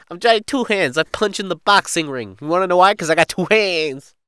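high, loud annoying mafia rookie; Johnny Two Hands. Acted. Rookie boasting of his boxing punch, loud and annoying. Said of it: "This is how he talks".

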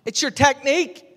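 high, loud yell from a mate rowing away; shouted advice. Retold or imitated. Mate yelling back across the water, high and loud. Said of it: "He shouted back".

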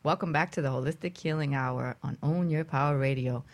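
ordinary delivery

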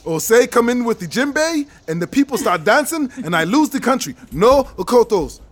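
African-accented president in mock alarm. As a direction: rule it out in a hammed-up African accent. Mock president imagines losing the country.

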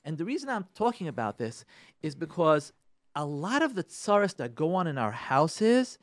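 ordinary talk

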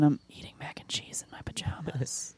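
whispered, very soft; hushed mock-seductive reply; mock sultry whisper. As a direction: whisper it like a late-night secret. Voicing a mock late-night message.

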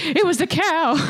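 high-pitched, hammed-up blame shifting; mock excuse. Culprit who knocked over a lantern, mock defensive. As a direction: pin it on the animal in a high put-on voice. Acted guilty person blaming the cow.

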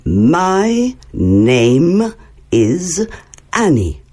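My name is, very slow and clearly spaced; slow model phrase. Shown as a demonstration. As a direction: spell it out slowly for beginners. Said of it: "répète après moi". (très lentement, mot par mot)

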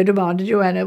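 ordinary delivery

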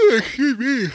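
words stretched slowly through a big yawn. Real, not acted. Tired host recording late at night, yawning. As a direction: let the yawn swallow the words.

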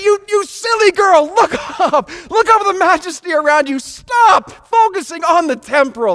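high, loud scolding shout; preacher shouts at a texting teenager. Retold or imitated. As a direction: yell at the girl to look up. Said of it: "I said".

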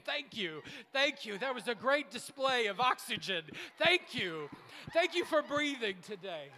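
loud, gushing tree thanks; clapping trees. Pretend. Trees clapping for the preacher, loud gushing thanks. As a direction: gush like applauding trees.

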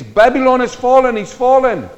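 a high, loud herald's cry; loud proclamation. Acted. Angel calling Babylon's fall to all nations, loud ringing proclamation. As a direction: ring it out like an angel announcing doom.

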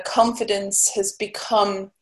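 usual speaking voice

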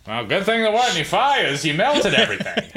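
high, put-on New York workman; mock New Yorker, laughing through it; New York accent. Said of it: "like, New Yorkian accent".

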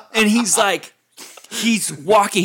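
ordinary delivery, nothing marked

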